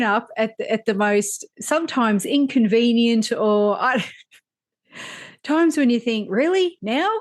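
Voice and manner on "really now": high-pitched incredulous questioning; mock disbelief. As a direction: question it as if the timing were absurd. Acting out thought at ill-timed visit.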